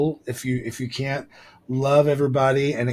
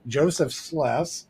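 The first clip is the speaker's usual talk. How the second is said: ordinary talk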